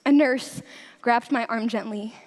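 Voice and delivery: ordinary delivery, nothing marked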